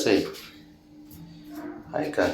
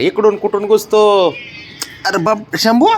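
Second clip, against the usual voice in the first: higher, louder alarmed call; startled high call after the runaway cat; startled cry; 10 semitones higher, 4 dB louder than usual. Real, not acted. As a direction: catch the cat slipping away in alarm. Cat darts off unexpectedly.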